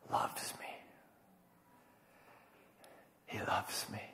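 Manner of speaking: hushed, breathy whisper; whispered awe. Acted. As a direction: drop to an intimate whisper. Hushed climax of sermon.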